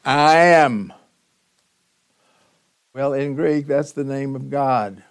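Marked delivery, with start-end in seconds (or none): 0.0-0.9 loud, raised "I am"; emphatic. Shown as a demonstration. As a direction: proclaim it boldly. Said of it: "So that's like saying".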